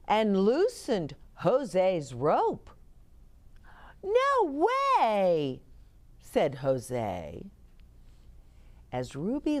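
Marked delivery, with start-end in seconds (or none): 4.0-5.6 high, drawn-out protest; disbelieving boy. A narrator's reading. Boy reacts to ruined rope.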